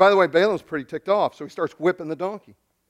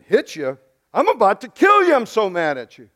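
hammed-up rage, spiking on kill; mock furious outburst; 5 semitones higher, 6 dB louder than usual. Preacher voicing Balaam raging at his donkey, mock shout. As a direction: voice jumps high and loud on the threat.

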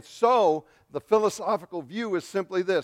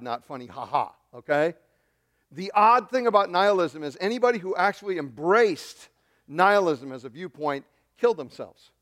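ordinary talk, nothing marked

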